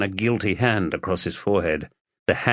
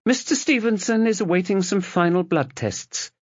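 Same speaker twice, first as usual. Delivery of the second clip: nurse voice; 11 semitones higher than usual. Acted.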